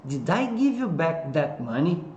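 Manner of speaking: "money" lifted at the end of the question; slow model question, raising the tune on "money"; rising question intonation. Shown as a demonstration. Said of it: "Ergue a entonação lá no final".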